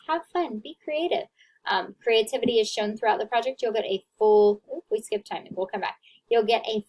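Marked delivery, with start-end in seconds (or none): 0.1-1.3 bright reading. Retold or imitated. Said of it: "Over here it says".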